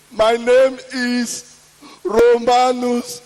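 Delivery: slow, deliberate self-introduction; drawn-out introduction. Acted. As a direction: say the name slowly and solemnly.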